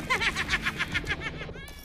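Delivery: high triumphant villain laugh; evil cackle. Acted. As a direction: cackle as she flies off. Thief escapes with the cat. (rire triomphant de méchante)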